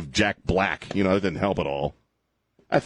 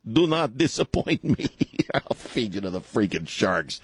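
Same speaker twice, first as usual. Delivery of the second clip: menacing villain threat, lightly played; mock Bond villain. Host plays Putin threatening a guest with sharks. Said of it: "like in the old James Bond movies".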